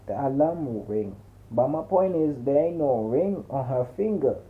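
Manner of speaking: ordinary delivery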